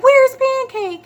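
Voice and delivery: very high, slow, panicky question; daughter alarmed. Retold or imitated. Daughter asking for her missing cat, high and alarmed. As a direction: cry it out high like a scared child. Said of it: "she was like".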